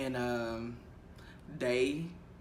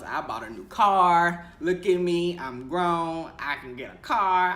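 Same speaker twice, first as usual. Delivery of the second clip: louder, playful bragging; proud boasting; 4 semitones higher, 7 dB louder than usual. Retold or imitated. Young teacher boasting about buying her first new car, louder. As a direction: show off like a proud new grown-up.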